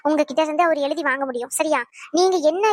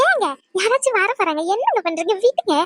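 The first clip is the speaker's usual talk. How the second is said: flustered protest; 5 semitones higher than usual. Acted. Husband pulls wife onto lap.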